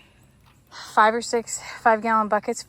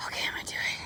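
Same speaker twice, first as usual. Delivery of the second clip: whispering; 12 dB quieter, much faster than usual. Real, not acted. Psyching up before tractor.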